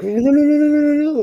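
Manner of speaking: high, panicked pleading; wife startled by a swinging husband. Retold or imitated. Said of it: "she's like".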